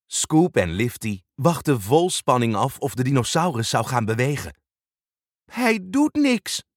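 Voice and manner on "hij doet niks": slow, let-down voice; disappointed. Acted. Scoop when the dino won't move, disappointed. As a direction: sag with disappointment. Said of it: "zei Scoop teleurgesteld".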